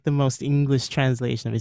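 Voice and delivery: ordinary talk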